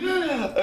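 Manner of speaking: sharp high intake of air; mimed drowning gasp. Retold or imitated. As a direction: imitate bobbing up for breath. Imitates boy gasping for air. (imitação de arfada aguda de afogado)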